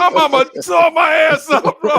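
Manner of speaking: high loud laughing outburst; laughing shout. Real, not acted. Telling friends he got beaten, loud laughing shout. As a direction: yell it through laughter.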